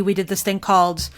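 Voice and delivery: ordinary talk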